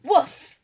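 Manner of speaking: sharp loud barked woof, high; loud dog woof. Shown as a demonstration. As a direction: bark it out like a dog. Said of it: "you're gonna go like".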